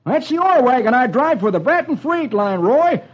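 high-pitched character voice; excitable Dolfo. Acted. Dolfo showing Roy his wrecked wagon, excitable high voice. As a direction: explain the wreck in an agitated high voice.

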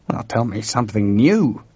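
ordinary delivery, nothing marked

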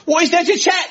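high-pitched indignant outburst; comic squawk of outrage; furious man. Retold or imitated. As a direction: imitate an outraged hotel guest, high and incredulous. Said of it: "this man was furious".

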